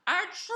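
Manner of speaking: high, loud theatrical sneeze; hammed-up storybook sneeze; bigger sneeze. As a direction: explode into a huge achoo. Wolf sneezes again.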